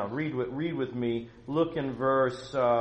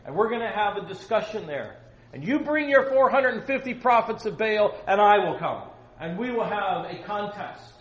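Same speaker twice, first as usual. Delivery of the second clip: high, loud prophet's summons; Elijah's shouted challenge; 7 semitones higher, 7 dB louder than usual. Acted. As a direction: call out the challenge to the king. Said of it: "So he's saying".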